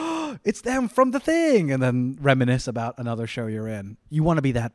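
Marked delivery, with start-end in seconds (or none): 0.0-1.7 high, slow gasp of recognition; light up as if spotting a familiar face; excited recognition. Retold or imitated. Fan spotting a familiar actor.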